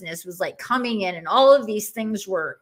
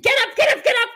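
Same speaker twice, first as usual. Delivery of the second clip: much higher, louder yelled get up; screaming command; 6 dB louder, much faster than usual. Retold or imitated. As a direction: scream it right into her face. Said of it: "somebody screaming in my face".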